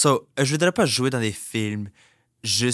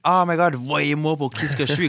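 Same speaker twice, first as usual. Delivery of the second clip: mock gushing, high and loud; show-off exclaiming; 5 semitones higher, 4 dB louder than usual. Performer imitating an attention seeker, mock show-off. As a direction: put on an over-excited look-at-me cry. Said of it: "je suis pas quelqu'un comme, like".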